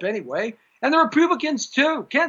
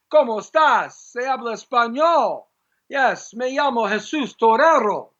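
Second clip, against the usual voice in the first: Spanish phrases; 4 semitones higher than usual. Shown as a demonstration. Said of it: "so much for my heritage".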